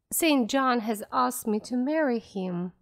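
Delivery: ordinary delivery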